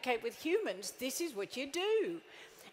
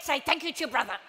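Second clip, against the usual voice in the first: louder, repeated firmly; exasperated parent repeats louder; insistent parent; 7 dB louder, faster than usual. Retold or imitated. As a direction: parent losing patience, louder. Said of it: "I said".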